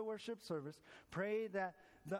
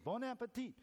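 a light French lilt on Bon appetit, said higher; French toast; 6 semitones higher than usual. Retold or imitated. Frenchman greets the American again at dinner, lightly French. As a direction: lift the glass, Bon appetit with a hint of French. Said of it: "The Frenchman said".

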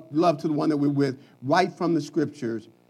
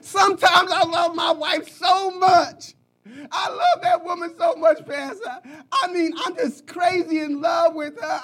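mock lovesick husband; 10 semitones higher than usual. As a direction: play the gushing fool, laughing through it. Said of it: "He said, Pastor".

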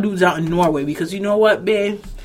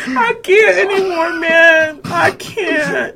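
high, slow laughing wail; too tickled to talk, voice way up high; helpless laughter; 9 semitones higher, 3 dB louder, much slower than usual. Real, not acted. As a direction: squeal it out while cracking up. Helpless laughter at rat joke.

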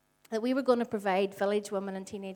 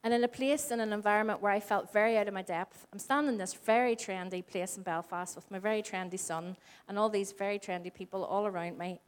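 ordinary delivery

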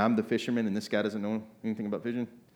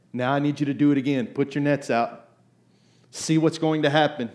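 louder, insistent call to put nets out; voicing God's command; 4 semitones higher, 7 dB louder than usual. God urging Peter to cast again, insistent. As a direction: press him to do it again.

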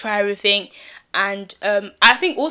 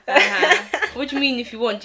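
laughing; 5 semitones higher than usual. Real, not acted. Laughs at friend's offer.

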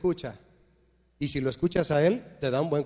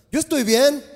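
smug self-assurance; 9 semitones higher, 5 dB louder than usual. Acted. Preacher mocks a self-satisfied man.

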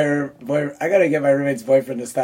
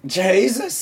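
higher-pitched Irish brogue attempt; Irish accent; 6 semitones higher than usual. Shown as a demonstration. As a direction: try it in Irish, rising at the end. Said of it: "I can just say Jesus and Murnin".

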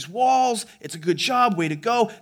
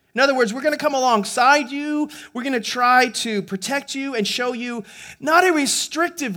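raised, ringing pulpit voice, pressing the point home; full-force preaching; 5 semitones higher than usual. Acted. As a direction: preach it out high and strong to the congregation.

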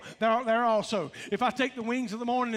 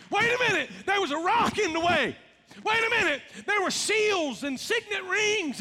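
excited preaching; 8 semitones higher than usual. Real, not acted. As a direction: push it up with amazement.

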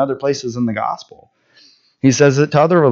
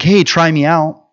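higher, breezy salesman-like plea; mock a laid-back try-me-out; easygoing mock Jesus, higher; casual pitch; 6 semitones higher than usual. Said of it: "He's not like".

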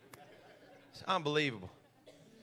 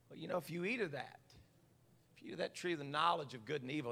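hushed serpent quote; 4 semitones lower, 10 dB quieter, faster than usual. Acted. Serpent's words voiced quietly.

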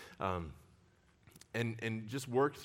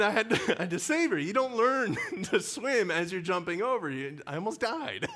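laughing; 7 semitones higher, 6 dB louder than usual. Real, not acted. Laughing retelling near drowning.

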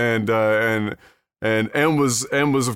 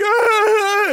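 high retold yelp of pain; mock ow. Man recalling crying out as a hurt child, mock yelp.

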